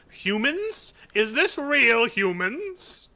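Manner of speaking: high, loud mock creature voice; hammy "fellow human" voice; dragon voice. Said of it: "Should I just go".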